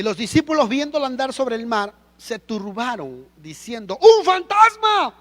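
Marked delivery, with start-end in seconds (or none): 4.0-5.1 high, very loud, drawn-out shriek; terrified scream. Acted. Disciples seeing Jesus walk on the sea, screaming in fear. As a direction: scream it out in panic, stretching the words. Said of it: "Y dieron voces de miedo. Comenzaron a gritar como niñas".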